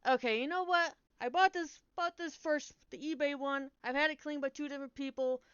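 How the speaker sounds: loud, high, fed-up venting; retold angry tirade; pissed-off rant. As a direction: rant about the dead cartridge. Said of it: "I was like".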